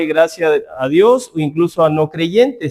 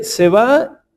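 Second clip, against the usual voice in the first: normal talking voice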